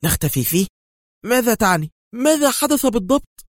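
shocked frightened woman; panicked surprise. A narrator's reading. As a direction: ask in startled fear. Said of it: "هتفت في دهشة هلعة".

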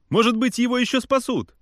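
high, loud pleading cry; desperate shout. A narrator's reading. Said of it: "кричал я".

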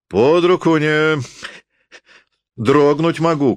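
high, drawn-out angry yell; wild shout. A narrator's reading. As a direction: lash out wildly at being interrupted. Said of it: "крикнул он дико". (дикий высокий окрик)

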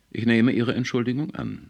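kühl und laut erwidert; förmlich abfertigen; kalt. A narrator's reading. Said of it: "erwiderte Foster kalt". (mit kalter Distanz)

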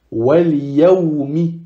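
slow wal yawmi with the L; lam pronounced. Shown as a demonstration. Teacher reading a ya example, lam pronounced. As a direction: sound the lam before the ya, slowly. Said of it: "Le ya également".

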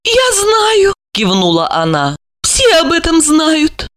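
of course everyone knows; high female voice; knowing lady. A narrator's reading. Olga voiced by narrator.

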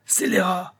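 high-pitched scolding outburst; angry interruption. A narrator's reading. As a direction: cut in, scolding the pupil. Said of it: "interrompit Pauluche".

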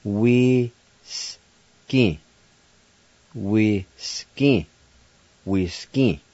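Whiskey said wis-kee, almost as in English; Cherokee word. Shown as a demonstration. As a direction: say Whiskey slowly and clearly, repeated. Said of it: "Pronounced like this".

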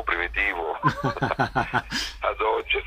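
detto lentamente tra le risate; ridendo. Real, not acted. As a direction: lascia scappare una risata a metà frase. Ride raccontando la comicità. (parla ridendo, piano)

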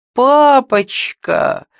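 high, loud, drawn-out wail. A narrator's reading. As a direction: howl it in protest to daddy. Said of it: "провыла она". (протяжно, с воем)

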